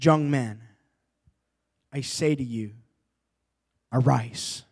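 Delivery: a lightly voiced Jesus raising the dead; solemn Jesus. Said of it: "And Jesus said".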